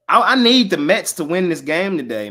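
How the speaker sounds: loud, high-pitched urgent plea; impassioned plea. Real, not acted. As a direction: plead for it loudly, leaning hard on the need. Pleading for his team's win.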